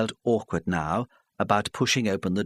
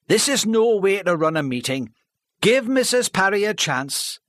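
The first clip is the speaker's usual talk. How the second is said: firm chairman; 10 semitones higher, 5 dB louder than usual. Acted. Fred chairs meeting.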